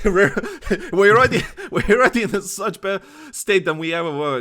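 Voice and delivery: loud, high words broken by laughter; real laughing. Real, not acted. Host jokes with co-host about the game's state, laughing. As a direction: crack up while getting the sentence out.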